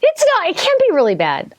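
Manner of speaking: high-pitched real disbelief; disbelieving protest. Real, not acted. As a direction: protest the bad news, starting very high. Disputes her bad time.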